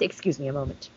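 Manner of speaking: low, hushed whisper, overcome with feeling; choked up. Acted. Verklempt after a scene that got to them, choked-up whisper. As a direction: drop to a soft whisper, as if too moved to go on. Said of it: "I am a bit verklempt".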